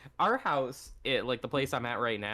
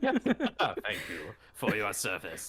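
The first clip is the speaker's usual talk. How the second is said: slow, lower put-on creature voice; fox cat voice; 4 semitones lower, much slower than usual. Imagined giant fox cat thanking a human, mock solemn. Voicing the grown fox cats.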